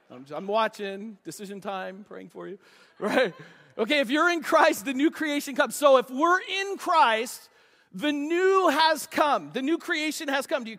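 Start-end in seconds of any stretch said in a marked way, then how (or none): 4.0-10.6 slow, loud, rising preacher cry on the new; preaching at full force. Acted. Pastor proclaiming to the congregation, building to a shout.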